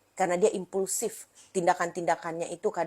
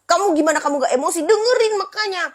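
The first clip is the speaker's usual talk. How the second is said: high, loud, rising to a sharp peak; irritated parent telling off a child; exasperated scolding; 9 semitones higher, 6 dB louder than usual. Retold or imitated. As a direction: lose patience with the kid.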